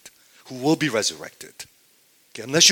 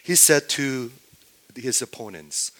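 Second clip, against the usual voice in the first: ordinary talk, nothing marked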